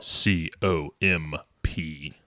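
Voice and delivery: slow, deep radio spelling. Shown as a demonstration. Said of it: "radio voice now".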